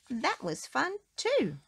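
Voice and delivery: usual speaking voice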